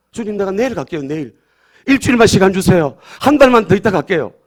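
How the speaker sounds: high, increasingly loud bargaining; mock pleading.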